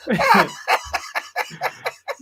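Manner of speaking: high burst of breathless laughter; real high laughter at the brawl. Real, not acted.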